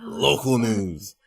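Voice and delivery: slow, drawn-out anchorman read of Local news; mock newscaster. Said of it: "What did you say it like that?".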